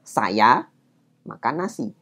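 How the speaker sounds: "saya" announced as the topic, a beat before "makan nasi"; Japanese-style topic pause. Shown as a demonstration. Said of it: "kalau bahasa Jepang tuh jadinya seperti itu".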